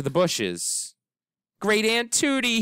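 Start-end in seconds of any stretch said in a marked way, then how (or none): 1.6-2.6 shouting. Acted. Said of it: "he shouted".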